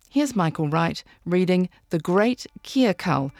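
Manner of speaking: ordinary talk, nothing marked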